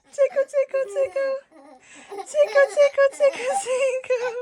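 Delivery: high, loud giggly tickling voice; giggly tickle attack. Real, not acted. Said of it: "tickling toddler playfully".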